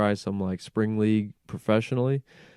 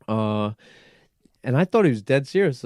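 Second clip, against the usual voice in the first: usual speaking voice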